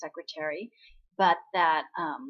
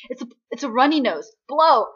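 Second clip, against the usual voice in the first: brisk insistence; 4 semitones higher, 10 dB louder than usual. Retold or imitated. Retold sister insisting, ordering him.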